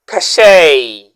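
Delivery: "Cachet" said ka-SHAY, the t silent, ending on "ay"; correct pronunciation. Shown as a demonstration. Teacher modeling "Cachet" for viewers, French pronunciation. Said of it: "Let's say it again".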